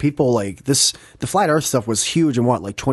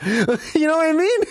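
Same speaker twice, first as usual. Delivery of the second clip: higher, laughing through the question; 12 semitones higher than usual. Real, not acted. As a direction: chuckle disbelievingly as you ask. Laughing at his own story.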